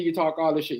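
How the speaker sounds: ordinary talk, nothing marked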